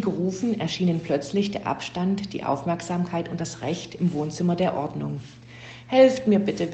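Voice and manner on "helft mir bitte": higher, louder pleading; whimpered plea. A narrator's reading. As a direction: plead as a storybook victim, pitched up. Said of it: "wimmerte die Ordnung".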